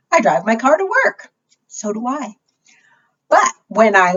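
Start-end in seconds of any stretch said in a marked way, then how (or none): 1.7-2.3 soft unvoiced whisper; hushed whispered aside. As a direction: breathe it as a little secret.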